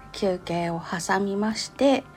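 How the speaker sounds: usual speaking voice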